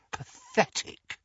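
brother's whispered scorn; scornful whisper. Acted. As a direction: breathe it in disgust. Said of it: "he told George".

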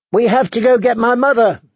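put-on high, insistent child's voice; pleading little girl. Girl begging the warrior to fetch her mother, high and insistent. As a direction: urge him on in a high child's voice.

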